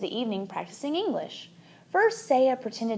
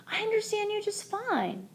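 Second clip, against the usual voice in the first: high, soft little squeak; a small creature squeaking reassurance; squeaky hamster; 10 semitones higher, 7 dB quieter than usual. A narrator's reading. Hamster assuring Saya, a high soft squeak. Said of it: "I frantically squeaked".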